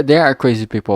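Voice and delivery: usual speaking voice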